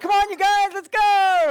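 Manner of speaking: put-on rally the kids to run off; excited call. Leader urging kids into forest, high excited call. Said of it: "So I'd be like".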